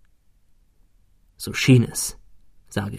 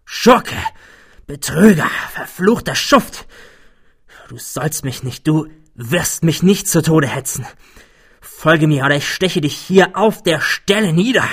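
hoarse with rage; 4 semitones higher than usual. Acted. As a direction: spit out the insults in blind fury. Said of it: "sagte ich mit vor Wut heiserer Stimme".

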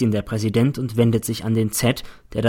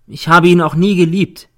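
louder, firmer declaration; girl insisting before the court, louder; a bit louder; 7 semitones higher, 7 dB louder than usual. A narrator's reading. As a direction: raise your voice a notch, defiant. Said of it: "sagt sie etwas lauter".

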